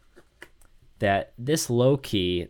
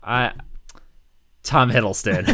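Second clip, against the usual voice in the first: mock surprise; 7 semitones higher, 9 dB louder, slower than usual. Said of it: "He goes".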